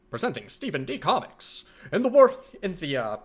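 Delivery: put-on high, loud old radio announcer; grand 1930s broadcast intro; old-timey radio voice. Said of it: "Old-timey radio voice".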